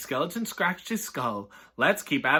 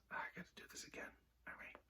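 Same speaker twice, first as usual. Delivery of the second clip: whisper; 27 dB quieter, much faster than usual. Real, not acted. Hushed aside ending the story.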